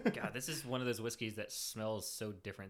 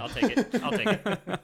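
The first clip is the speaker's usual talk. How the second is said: loud laughing repeat; 11 semitones higher, 16 dB louder, slower than usual. Real, not acted. Joking acceptance, laughing loudly.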